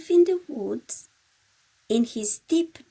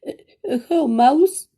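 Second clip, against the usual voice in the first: louder, stammering hare greeting; shy hare stumbles into hello; hesitant hare; 4 semitones higher, 8 dB louder, much faster than usual. Acted.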